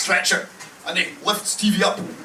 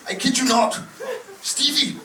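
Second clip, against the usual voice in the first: ordinary talk, nothing marked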